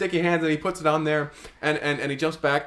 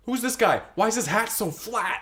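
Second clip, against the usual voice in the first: high freaked; 9 semitones higher than usual. Acted. High Charles sees flat man.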